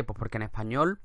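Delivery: usual speaking voice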